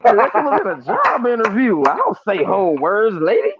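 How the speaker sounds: high, indignant protest; mock offended. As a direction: play up the outrage at being called proper. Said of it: "I was offended".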